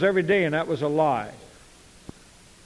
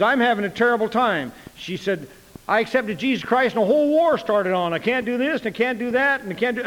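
higher-pitched exasperated complaining; acted complaint; 7 semitones higher than usual. Young convert pouring out her troubles to the preacher, exasperated. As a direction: imitate a put-upon new believer.

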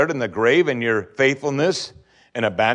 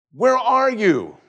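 high, slow, drawn-out plea to heaven; anguished cry; 8 semitones higher, much slower than usual. Acted. Preacher voicing the psalmist crying to God, high slow anguish. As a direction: cry it up toward God, stretched out.

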